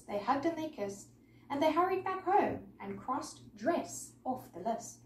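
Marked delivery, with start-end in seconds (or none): none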